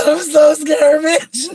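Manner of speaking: mock scared, high and loud through laughter; half-screaming the joke through laughs; laughing. Real, not acted. Joking to viewers about learning Spanish for survival, high laughing mock-scared yell.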